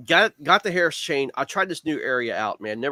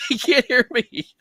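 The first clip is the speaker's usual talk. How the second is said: ordinary talk, nothing marked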